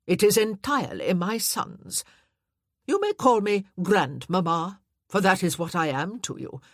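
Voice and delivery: haughty dowager. Acted. Dowager lectures Anna.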